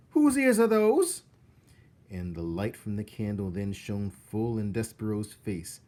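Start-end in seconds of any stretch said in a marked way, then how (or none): 0.1-1.2 high, loud astonished cook; cook's surprise. Acted. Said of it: "said Cook".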